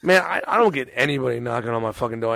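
ordinary talk

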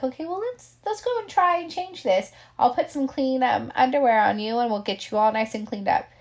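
high, gentle coaxing voice, like talking a client into changing; coaxing caregiver. Retold or imitated. Caregiver coaxing her elderly client to change, high and gentle. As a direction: sweetly persuade her to get cleaned up.

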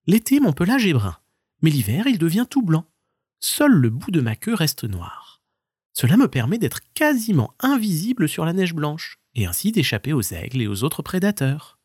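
high stoat; light high answer. Acted. Stoat answers the marmot, high and light.

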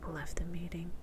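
hushed whisper to herself. Real, not acted. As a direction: whisper it under your breath. Whispering narration of call glitch.

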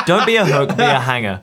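loud laugh then a laughing shout. Real, not acted. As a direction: burst out laughing. Laughing at own joke.

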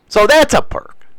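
ordinary delivery

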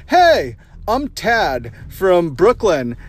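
mock hipster video intro, high loud "hey", drawn-out name; Tad, hipster anchor. Podcaster mocking hipster anchors' video intros, hammed-up anchor voice. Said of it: "young, trendy, millennial, hipster anchors ... they intro their videos".

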